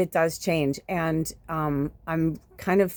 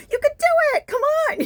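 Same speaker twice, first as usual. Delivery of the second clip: very high, loud cheering; shrieking cheerleader encouragement; 7 dB louder than usual. Shown as a demonstration. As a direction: yell it like you're pumping up the team from the sidelines. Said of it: "I'm a natural, like".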